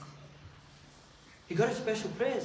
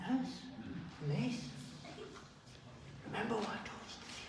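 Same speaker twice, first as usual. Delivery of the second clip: gentle reminder; 14 dB quieter than usual. Acted. Prophet gently reminds the boy.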